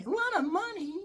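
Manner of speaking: very high, outraged echo; incredulous echo. Retold or imitated. Employee repeating the cheap boss's complaint, incredulous. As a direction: fling the words back in disbelief.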